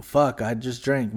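ordinary delivery

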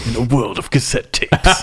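laughing trailer announcer voice; booming movie trailer narrator, half laughing; trailer voiceover voice. Pretend. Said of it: "the trailer voiceover man".

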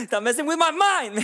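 high, loud playful outburst; mock exasperated shout. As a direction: cry out in fake frustration.

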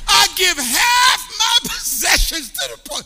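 loud, high, slow and joyful; elated shout. Acted. Zacchaeus promises half to the poor, elated shout. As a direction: proclaim the gift with glee. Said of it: "he's elated".

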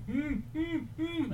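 high, muffled moans through a gag; muffled gagged moans. Acted. Strapped man moaning through a gag, high and muffled. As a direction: moan like someone gagged and tied down.

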